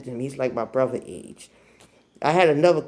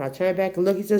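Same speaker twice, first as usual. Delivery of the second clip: ordinary delivery, nothing marked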